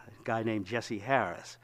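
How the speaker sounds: ordinary delivery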